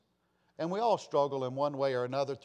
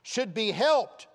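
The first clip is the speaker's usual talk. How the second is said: loud, high, slowed preaching build; fiery preaching; 7 semitones higher, 6 dB louder than usual. Acted. Preacher rousing the congregation, loud and high. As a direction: thunder it from the pulpit.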